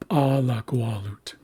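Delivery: Alagualut as PA-la-gua-lut, popped P first; first-syllable stress. Shown as a demonstration. Teacher repeats the next example, stress on first syllable. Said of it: "it shifts back to the first syllable".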